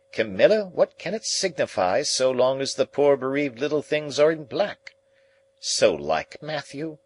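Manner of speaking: high, exasperated ladylike retelling; scandalised relative in a high voice; complaining lady. A narrator's reading. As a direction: quote him with high-pitched disapproval. Camilla quotes Matthew and complains.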